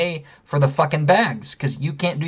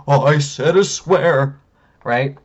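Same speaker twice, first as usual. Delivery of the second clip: mock scandalized; 4 dB louder, slower than usual. Mocking himself for swearing.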